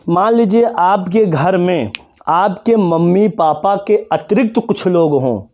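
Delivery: ordinary delivery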